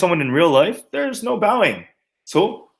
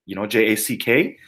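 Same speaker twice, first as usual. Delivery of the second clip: ordinary delivery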